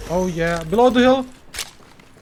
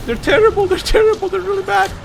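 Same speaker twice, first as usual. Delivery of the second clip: much higher outraged whine; high squeal; 14 semitones higher than usual. Real, not acted. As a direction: squeal it in disbelief. Squealing at enemies.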